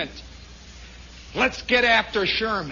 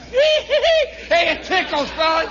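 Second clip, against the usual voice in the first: very high giggly yell; loud, hammed-up shriek of amusement; mock ticklish glee; 10 semitones higher, 5 dB louder, slower than usual. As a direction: squeal as if tickled.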